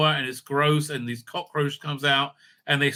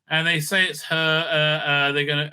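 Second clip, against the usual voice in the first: ordinary talk, nothing marked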